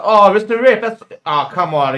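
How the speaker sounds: loud, high protest at the ref; exasperated shout at the referee; indignant protest. Real, not acted. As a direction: cry out at a bad call.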